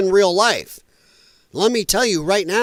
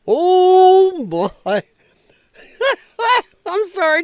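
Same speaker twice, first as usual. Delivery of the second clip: laughing; 12 semitones higher, 4 dB louder, slower than usual. Real, not acted.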